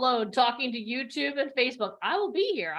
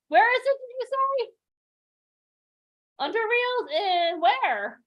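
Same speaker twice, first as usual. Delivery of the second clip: much higher and louder, thrilled; real excitement; 8 semitones higher, 4 dB louder than usual. Real, not acted. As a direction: burst out with the surprise news. Surprised news mid-session.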